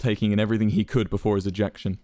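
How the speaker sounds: ordinary delivery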